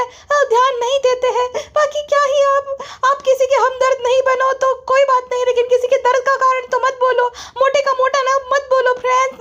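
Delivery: very high-pitched, pleading parody voice; mock whiny vlogger. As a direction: plead with the viewers in a squeaky, hammed-up falsetto. Mocks the vlogger answering her critics. (बहुत ऊँची आवाज़ में vlogger की गिड़गिड़ाती नकल)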